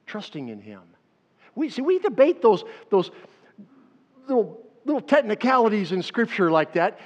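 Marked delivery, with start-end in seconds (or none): 1.5-7.0 loud, higher, animated; lively aside. Real, not acted. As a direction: tease the nitpicky debates.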